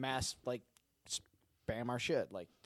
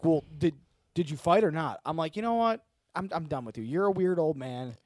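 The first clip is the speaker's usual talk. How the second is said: loud, higher-pitched mock exasperation; retold fed-up retort; 5 semitones higher, 7 dB louder than usual. Host retells telling off a weird old man, mock exasperation. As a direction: act out brushing off a weirdo, loudly. Retelling his exchange with Terry.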